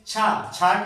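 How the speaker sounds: छा said as an aspirated CHHA, no k-sh; wrong क्ष as chha. Shown as a demonstration. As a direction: mispronounce the conjunct as छा, "chha". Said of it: "pronounce करते हैं".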